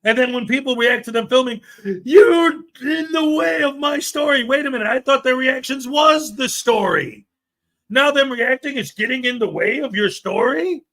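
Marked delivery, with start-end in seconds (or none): 2.0-4.4 high, whiny-outraged imitation of a complainer; mock indignant shout. Host imitating a filmer objecting to people's reactions, indignant. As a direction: shout it like an offended mock victim.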